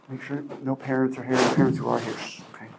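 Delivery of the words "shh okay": hushed shh; whispered aside to the room. Acted. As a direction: whisper it like a secret. Hushing the audience.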